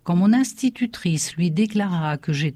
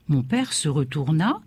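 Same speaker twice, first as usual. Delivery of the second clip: plain reading voice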